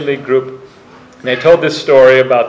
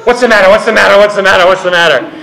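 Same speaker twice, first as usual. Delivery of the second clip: higher and louder, a worried mother asking again and again; anxious pestering; 6 semitones higher, 5 dB louder, faster than usual. Retold or imitated. Mother anxiously pestering her crying daughter, insistent. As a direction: press her for an answer, fretting.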